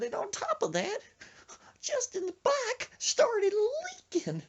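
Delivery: hammed-up tearful voice, drawing out the words; scared friend. Frightened friend reports leak to dad, high whiny imitation. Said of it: "he does that when he gets scared".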